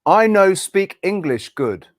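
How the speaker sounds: slow, halting English of a Spanish beginner; broken learner's English. Acted. Spanish beginner telling an English speaker their level, slow halting English. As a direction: lay out each word separately, haltingly. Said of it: "if that was a Spanish person speaking English".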